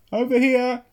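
high, thin distant call. A narrator's reading. Hidden father answering his son, calling out. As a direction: answer from far off in the wood. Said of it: "the voice called out".

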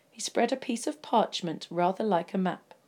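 usual speaking voice

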